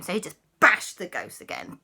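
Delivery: plain reading voice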